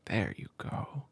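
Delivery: breathy whisper, no voice. Acted. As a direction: whisper it right against the ear. Whispered repeat to pet.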